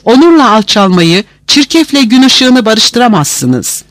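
high, impassioned protest; defiant objection. A narrator's reading. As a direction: refuse reconciliation like a slogan-charged speech. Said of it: "itirazlar yükseldi".

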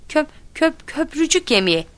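high, halting answer; stammered schoolboy answer; stuttering. A narrator's reading. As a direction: stumble on the first syllable, then get it out. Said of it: "Kekelemekten ter içinde kalmıştı".

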